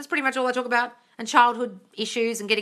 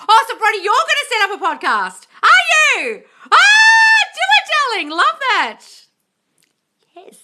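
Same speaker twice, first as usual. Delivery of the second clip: very high, loud delighted cheering; shower him with thrilled encouragement; gushing cheer; 11 dB louder than usual. Real, not acted. Host cheering Brody on his podcast plan, very high and loud.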